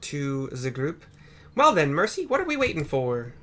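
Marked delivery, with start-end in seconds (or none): 1.6-3.3 higher and louder, bursting to go; a keen reporter itching to leave; eager Lena. Acted. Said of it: "says Lena".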